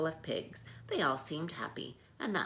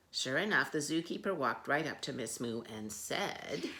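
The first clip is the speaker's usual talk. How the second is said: usual speaking voice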